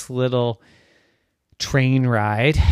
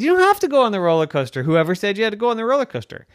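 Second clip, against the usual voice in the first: incredulous reassurance; 10 semitones higher, 3 dB louder, much faster than usual. Acted. Mommy protests Daphne's worry.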